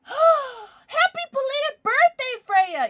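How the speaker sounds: high, loud, slow squeal; gushing wish. Real, not acted. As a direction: gush the greeting. Birthday wish to a viewer.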